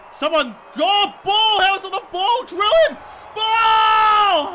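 high, loud appeal to the umpire; outraged footy fan shout; yelling at the game. Real, not acted. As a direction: bellow for a free kick, last word drawn out. Yelling for a free kick.

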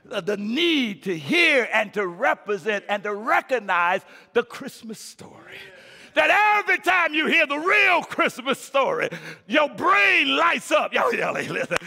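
loud, high, shouted sermon climax; preaching at full force. Acted. Pastor rousing his congregation at Christmas, full-force shouted preaching. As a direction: whip the church up with a ringing shout.

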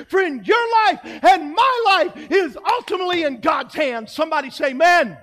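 high, loud climax calling out to the church; preaching at full force. Acted. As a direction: build to a shouted altar call.

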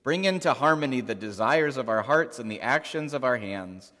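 ordinary delivery

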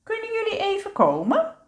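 very high, calling question; tante Perenboom. A narrator's reading. Aunt calls the youngsters over to her, high calling voice. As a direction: summon them in a high, lilting voice. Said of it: "klinkt de stem van tante Perenboom".

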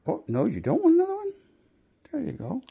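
talking to a dog. Real, not acted. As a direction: squeal the question at the dog. Asking dog about treat.

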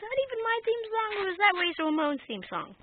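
high, loud, fast surprised outburst. Acted. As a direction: blurt it out, startled by the music. Hears entrance music.

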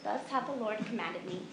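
plain reading voice

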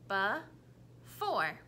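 before said slowly, be and fore apart; chopped into syllables. Shown as a demonstration. Teacher chopping the word into syllables. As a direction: chop before into its beats. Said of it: "tell me the parts or the syllables".

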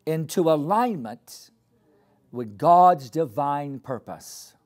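slow, spaced declaration, each phrase left hanging for the crowd; slow call to repeat. Shown as a demonstration. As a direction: lead the congregation line by line. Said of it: "I want you to repeat after me".

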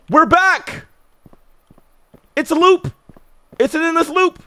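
high loud panicked exclaiming; freaked out yelling about the loop; alarmed realization. Real, not acted. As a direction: shout it as the trap dawns on you.

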